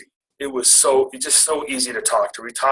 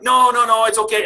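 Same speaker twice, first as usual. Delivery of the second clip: nervous backpedal; 10 semitones higher, 4 dB louder than usual. Retold or imitated. Nervously declining.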